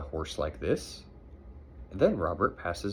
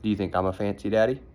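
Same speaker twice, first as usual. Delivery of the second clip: teasing; 4 dB louder, much faster than usual. Real, not acted. Parent teasing child mid-reading.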